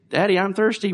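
high, lightly voiced kid; a hint of a whining child; child's whine. Father imitating his child asking for a drink, lightly high. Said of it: "when my children say".

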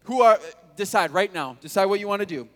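loud, high, daring the crowd to choose; bold challenge. Acted. Elijah challenging the eight hundred fifty prophets, loud and daring. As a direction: call them out, loud and pushy. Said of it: "He's just like".

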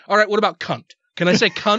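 a touch of fed-up pushiness; retold frustration. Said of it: "I was getting a little frustrated".

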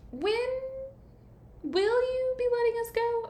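very high, halting mock-timid question; imitated nervous dad; tentative question. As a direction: creep up in pitch, asking carefully. Scared dad asks carefully.

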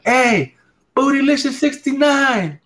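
high, bright greeting called across a store; high mock hello; high calling-out voice. Joke: calling out to friend.

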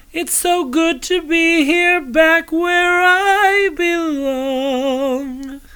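high, sweeping diva greeting; drag diva voice. Acted. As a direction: sing out the hello like a drag queen. Diva host continues.

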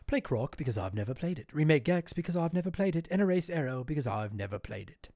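put-on a mild, proper British accent for the listener. Host reads a listener's comment in a mild British accent. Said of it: "who I imagine speaks in a very, uh, British accent".

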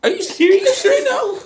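high-pitched incredulous question with a laugh; mock disbelief. Looking at sisters over shoes on carpet, mock disbelief.

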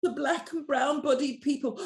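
high-pitched, put-on Karen voice; fake Karen accent. Mocking a coach offering bursaries to look inclusive, fake Karen accent. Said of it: "stop doing my fake Karen accent".

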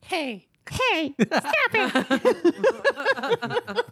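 high-pitched, hammed-up menace; comically high mock-menacing voice; attempted menace. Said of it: "in my most menacing voice".

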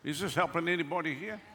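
ordinary talk

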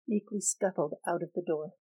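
ordinary delivery, nothing marked

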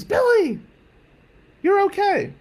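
high, loud mock scream; screaming aunt. Aunt to nephew arriving home at night, mock scream. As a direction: shriek it as a hysterical aunt, hammed-up. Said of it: "Aunt Mary screams".

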